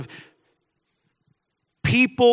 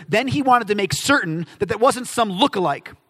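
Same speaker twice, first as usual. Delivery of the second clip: normal talking voice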